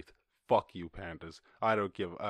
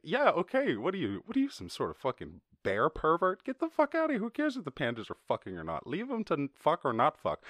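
much higher, incredulous scolding; pitched-up outraged scolding; exasperated mock rant; 5 semitones higher than usual. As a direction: tell off a creep in disbelief. Berating panda watchers.

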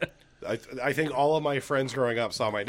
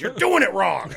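higher, louder mock scolding; 7 semitones higher, 8 dB louder than usual. Dad correcting son's Beetlejuice, mock-angry yell.

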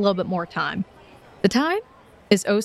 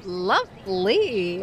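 drawn-out lovely; much slower than usual. Real, not acted. GM impressed by a high roll.

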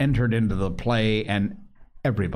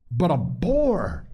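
higher, slow, incredulous cry of "boar"; voice running up; 9 semitones higher, slower than usual. A narrator's reading. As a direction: pitch climbs on "But a boar!". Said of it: "Ralph's voice ran up".

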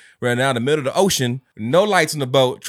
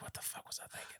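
breathy whispered self-scolding; whispering; 29 dB quieter, faster than usual. Retold or imitated. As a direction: whisper it to yourself. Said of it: "I was like".

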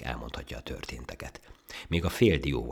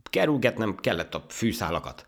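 high, louder self-justification; defensive; 8 semitones higher, 5 dB louder than usual. Acted. Ant explaining its lost race, defensive. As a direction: plead your excuse. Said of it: "védekezett a hangya".

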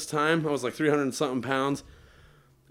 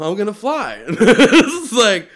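giddy, laughing and louder; 6 semitones higher, 10 dB louder, slower than usual. Retold or imitated. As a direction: laugh through it, thrilled. About to take off, laughing.

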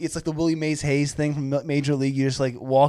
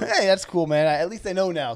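normal talking voice

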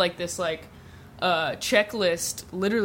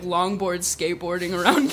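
ordinary talk

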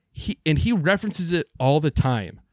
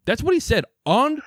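heated; 10 semitones higher than usual. Real, not acted. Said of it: "I got heated there once again".

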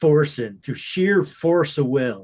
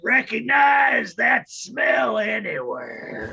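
zombie snarl; 8 semitones higher, slower than usual. Acted. Zombie player shouts in character.

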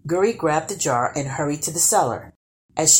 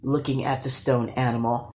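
ordinary delivery

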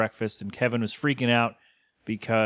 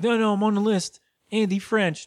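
high-pitched protesting imitation; mock insistence; 9 semitones higher than usual. Man telling security he is on the list, high mock protest. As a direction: plead in a raised, put-on voice.

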